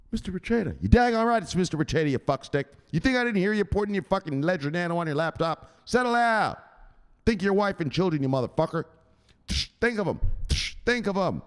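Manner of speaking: high, scolding enforcer role. Acted. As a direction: threaten the viewer like a mob enforcer. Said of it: "'Cause he'll tell you".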